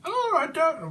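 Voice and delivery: very high mock voice; squeaky character voice. Imitating a little thing on TV, very high voice.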